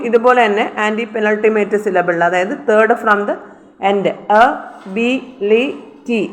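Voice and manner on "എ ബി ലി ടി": എ ബി ലി ടി with a pause after each syllable. Shown as a demonstration. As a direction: count out എ ബി ലി ടി one by one. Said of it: "ഒരു സിലബിളാണ്".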